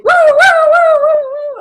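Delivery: high wailing woo-woo of a police siren; siren imitation. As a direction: mimic a siren going by, loud and high.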